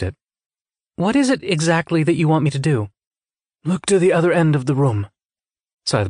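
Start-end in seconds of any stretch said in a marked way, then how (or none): 1.0-2.9 higher-pitched, pressing question; questioning character. A narrator's reading. Citra questions the scythes, tense scene. 3.6-5.1 higher character voice giving an order; senior scythe instructing. A narrator's reading.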